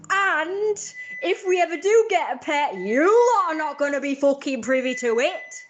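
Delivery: loud rant. Real, not acted. Ranting loudly about neighbours.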